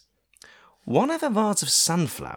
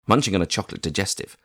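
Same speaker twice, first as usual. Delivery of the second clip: usual speaking voice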